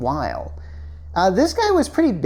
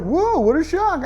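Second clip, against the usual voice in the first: mock amazement; 11 semitones higher than usual. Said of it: "he's kinda looking like".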